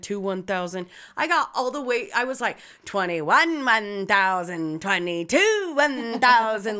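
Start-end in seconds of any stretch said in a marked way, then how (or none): 2.8-6.8 loud, drawn-out mocking count; messing around. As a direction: stretch each number out loudly to taunt. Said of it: "I started fucking with him".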